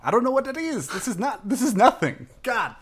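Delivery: very high, laughing self-mockery; exasperated squeal. Real, not acted. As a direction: despair at your own bad impression, voice shooting up. Despairing at own failed accent.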